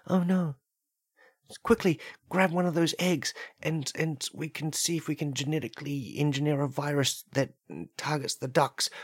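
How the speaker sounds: high-pitched urgent worry; frightened human in skit. Acted. Human plots against the ducks, high and worried.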